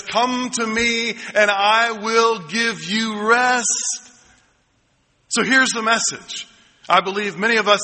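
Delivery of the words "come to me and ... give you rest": slow, gentle voice of Jesus inviting; tender slow invitation to the weary. Acted. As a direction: offer rest, slowly and kindly. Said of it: "He says".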